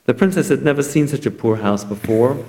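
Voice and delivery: plain reading voice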